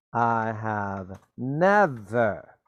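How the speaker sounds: drawn-out, slow, leaning on never; slow stressed never. Shown as a demonstration. Teacher modelling the answer, slow and deliberate. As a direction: stretch it out to show never. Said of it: "by using the word never".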